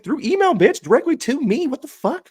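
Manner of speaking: hammed-up fury at the caller; mock outraged yell. Telling a friend about the call, a mock outraged yell.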